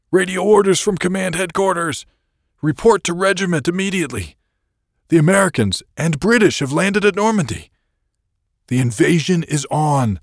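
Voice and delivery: high, loud officer shouting urgent orders; excited bark. A narrator's reading. Officer shouting invasion news to his soldiers, excited bark. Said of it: "He barked excitedly".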